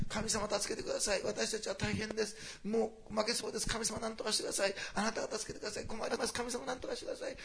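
whining plea for rescue; desperate pleading prayer. Pretend. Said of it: "こういうふうには歌ってないんです". (悲壮感のある嘆願の声)

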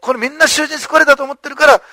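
usual speaking voice

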